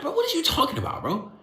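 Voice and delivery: high, fast baffled bro; exasperated. Retold or imitated. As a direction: throw up your hands in disbelief. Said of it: "I'm like".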